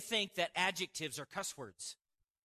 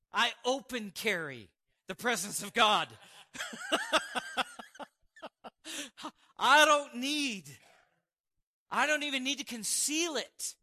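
loud, emphatic preacher punchline; rising preacher's declaration, louder; preaching at full force; 5 semitones higher, 8 dB louder than usual. Real, not acted. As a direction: proclaim it boldly to the crowd.

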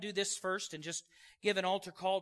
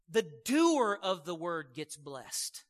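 slow, deliberate sermon stress; slow preaching emphasis; 2 semitones lower, 3 dB louder, much slower than usual. Real, not acted. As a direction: lay each word down slowly for the congregation.

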